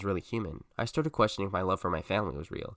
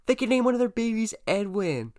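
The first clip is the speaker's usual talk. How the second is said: very high, loud fangirl squeal; hammed-up high-pitched gushing; dramatic excited shout; 8 semitones higher, 6 dB louder than usual. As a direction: shout it in giddy, over-the-top excitement. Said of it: "gasped dramatically and shouted".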